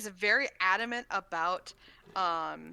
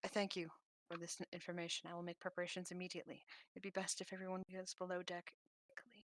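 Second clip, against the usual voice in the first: quiet aside; 11 dB quieter, faster than usual. Acted. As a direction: murmur it so no one else hears. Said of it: "but whispers in your ear".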